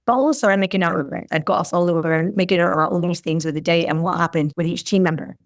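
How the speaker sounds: ordinary delivery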